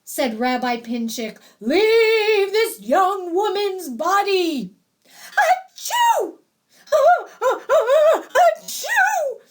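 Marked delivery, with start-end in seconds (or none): 1.6-4.7 loud, high, drawn-out incantation; commanding exorcist. A narrator's reading. Rabbi drives the dybbuk from the bride, commanding loudly. 5.0-9.4 very high, loud acted sneezes; loud sneezing. A narrator's reading. Possessed bride in candle smoke, sneezing loudly. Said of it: "Leah sneezed".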